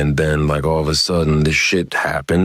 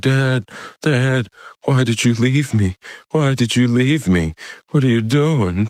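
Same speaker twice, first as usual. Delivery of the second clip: high, pleading imitation of a distraught boy; upset son; 8 semitones higher than usual. Son asks dad why he left him, upset and pleading. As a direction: plead in a high mock-child voice. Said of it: "he started crying".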